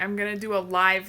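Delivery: ordinary talk, nothing marked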